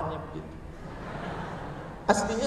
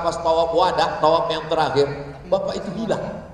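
normal talking voice

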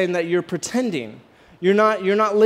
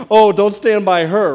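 mock warning; 8 dB louder than usual. Runner mocking the 666 number.

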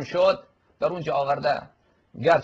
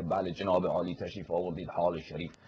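lower, softer mock Qom cleric politeness; hushed, lower parody of ornate seminary greetings; Qom cleric courtesy; 6 semitones lower, 6 dB quieter than usual.

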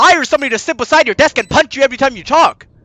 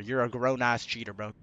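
low, soft repeated verdict; muted condemnation; 16 dB quieter than usual. Real, not acted. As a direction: pass sentence under your breath. Sentencing the cheater in game.

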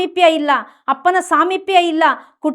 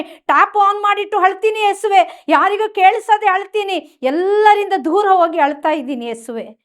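raised, insistent cry to God; pleading prayer; 4 semitones higher than usual. Acted. Preacher voicing a hurting child praying to Jesus, pleading. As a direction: plead with Jesus in a high, urgent voice.

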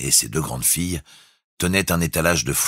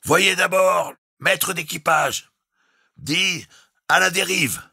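high, loud, slow shouted orders; shouting over storm; 4 dB louder, slower than usual. A narrator's reading. The captain shouts his orders into the storm. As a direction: bellow it over the gale.

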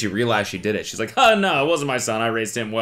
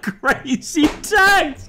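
laughing; 3 dB louder, much slower than usual. Real, not acted. Laughing aside.

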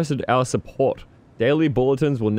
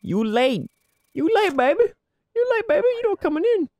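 high, sassy gatekeeper. Pretend. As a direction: tease them in a high sassy voice. Voicing game guard turning someone away.

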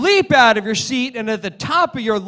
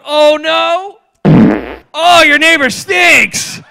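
slow, loud and higher, cracking up; laughing shout; 5 semitones higher, 6 dB louder, much slower than usual. Acted. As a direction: blurt it out through laughter. Stink suggestion, laughing.

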